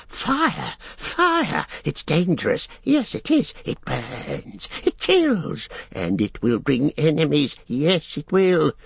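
very high, frightened creature voice; panicked Gollum. A narrator's reading. Gollum warns Sam fire brings enemies, panicked squealing. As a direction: squeal warnings, then hush in dread.

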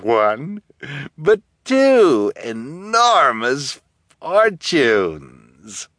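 slow, relishing villainess gloat; cruel villainess. A narrator's reading. Guardian gloating over three trapped orphans, cruel and drawn out. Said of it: "could still hear every cruel word".

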